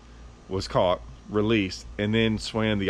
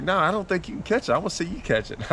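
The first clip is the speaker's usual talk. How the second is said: taunting boss; 9 semitones higher than usual. Retold or imitated. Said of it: "He was like".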